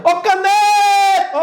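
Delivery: high, slow call stretching "ne" in "caneta"; calling out the word. Shown as a demonstration. Teacher showing which syllable is tonic in caneta, calling out. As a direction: holler it, holding the stressed syllable. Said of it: "Agora grite ela".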